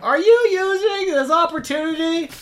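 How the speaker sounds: high, loud yell from next door; from another room. Acted. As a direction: shout it through the wall. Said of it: "Eugene yells from the other room".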